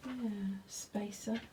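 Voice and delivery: soft, slowed whisper. Real, not acted. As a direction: breathe it under your voice. Quiet aside while reading instructions.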